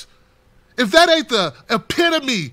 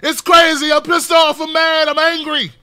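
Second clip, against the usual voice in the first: higher, louder mock outburst; mock angry rant; 7 semitones higher, 5 dB louder than usual. Vlogger mocking protesters, a higher, louder mock outburst. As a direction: parody a pointless angry yell. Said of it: "We can't just be like".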